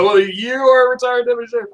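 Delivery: high-pitched retold astonishment; amazed reply. Speaker replying to the retired deputy's badge, mock amazement. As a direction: react amused and amazed, voice jumping up.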